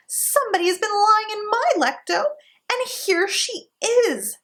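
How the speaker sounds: put-on very high tiny baby bear, slowing at the end; baby bear's high wee voice; little small wee voice. A narrator's reading. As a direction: squeak it, then draw out here she is. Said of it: "in his little small wee voice".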